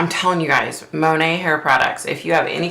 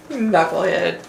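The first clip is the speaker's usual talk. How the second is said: ordinary talk, nothing marked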